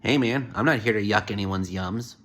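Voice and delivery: normal talking voice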